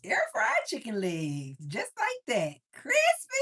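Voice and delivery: high, loud excited cry; excited shout. Real, not acted. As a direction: squeal with delight. Shouting delight over the chicken.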